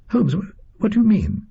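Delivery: high-pitched, stammered bewilderment; baffled. Acted. Watson asking Holmes to explain his strange remark, baffled. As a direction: stumble into a startled, high question.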